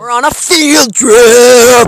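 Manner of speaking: slow loud proclamation; loud announcement. Acted. Mock announcement.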